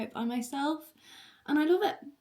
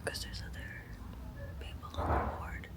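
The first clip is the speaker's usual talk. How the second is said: hushed whisper so others won't hear; whispering on the phone; 3 semitones higher, 6 dB quieter, faster than usual. Real, not acted. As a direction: whisper quietly on a hospital ward. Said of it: "I have to whisper".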